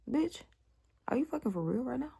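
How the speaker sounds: high, stunned disbelief; can't believe what she just heard; incredulous. Retold or imitated. To her cousin, high incredulous disbelief. Outraged retort at cousin's answer.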